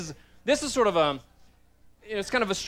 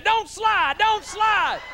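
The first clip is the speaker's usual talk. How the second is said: crowd yelling; 14 semitones higher, 3 dB louder, much slower than usual. Retold or imitated. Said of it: "stood up and said".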